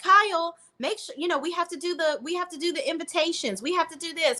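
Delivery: high whiny nagging bride voice; mock nagging Amanda. Mocking Amanda nagging Kyle.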